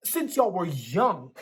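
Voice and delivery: the father lectures his son, voice climbing. A narrator's reading. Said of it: "His voice began to rise".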